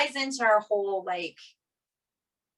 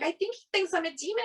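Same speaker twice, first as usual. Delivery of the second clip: higher, faster insistent repeat; telling the husband a second time, higher and faster; exasperated repeat; 11 semitones higher, faster than usual. Retold or imitated. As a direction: press the point, incredulous.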